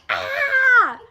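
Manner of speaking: loud, high yelp of pain; acted pain scream. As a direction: scream as if jabbed by a needle. Acts out second needle stick.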